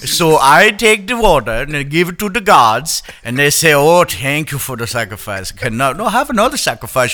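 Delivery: Prince Julian. Acted. Cartoon lemur explaining offerings to the gods, put-on accent. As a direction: tell it as the put-on lemur character. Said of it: "Prince Julian".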